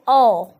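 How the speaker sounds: "O" as an open, rounded 'aw'; correct vowel. Shown as a demonstration. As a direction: sound out O as in pot. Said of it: "It has to be". (O की खुली 'ऑ' आवाज़)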